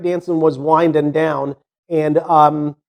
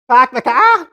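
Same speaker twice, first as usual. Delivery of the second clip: mocking Boston accent, raised pitch; exaggerated Boston accent as a disbelieving question; 5 semitones higher than usual. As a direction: quote it back incredulously in broad Boston, no R's. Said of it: "Have you listened to how you talk?".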